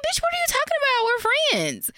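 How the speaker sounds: high, fast and dismissive; high sassy retort. Pretend. Imagining brushing off her friend in the hallway, high and sassy. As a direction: toss it off high and quick.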